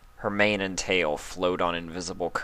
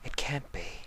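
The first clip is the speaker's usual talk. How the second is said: much softer, a hushed breath of shock; stunned disbelief; 6 semitones higher, 10 dB quieter than usual. Acted. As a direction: barely voice it, thrown by the discovery. Stunned disbelief at seeing Spike.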